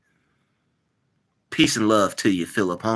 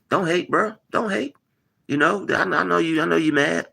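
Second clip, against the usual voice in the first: teasing sing-song; 8 semitones higher than usual. Acted. Teasing the hater in chat.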